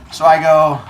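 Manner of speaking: ordinary delivery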